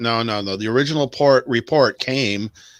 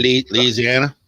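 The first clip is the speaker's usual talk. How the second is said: mock Louisiana accent; 2 semitones higher than usual. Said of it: "That's some quality accent right there".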